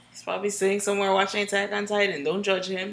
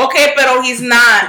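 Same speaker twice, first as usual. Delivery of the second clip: high, very loud playful protest; loud objection; 4 semitones higher, 11 dB louder than usual. Real, not acted. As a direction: cut through the teasing at top volume. Cuts through teasing in banter.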